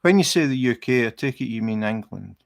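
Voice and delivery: ordinary talk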